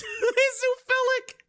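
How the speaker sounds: high, slow words squeezed out through laughter; laughing squeak. Retold or imitated. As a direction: lose it laughing on the last words. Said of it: "And he's like".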